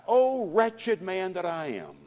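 desperate apostle's wail; crying out. Retold or imitated. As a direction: cry out in wretched despair. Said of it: "he cried out".

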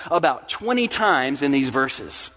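normal talking voice

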